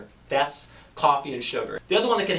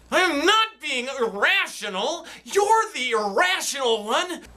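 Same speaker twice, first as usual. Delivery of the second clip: defensive; 9 semitones higher than usual. Acted. Skit, defensive argument.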